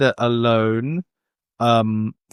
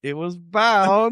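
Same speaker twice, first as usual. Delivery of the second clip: very slow, pitched-up dramatic build; hammed-up build-up; 6 semitones higher, slower than usual. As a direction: stretch each word for mock suspense. Drawn-out hammed build-up to reveal.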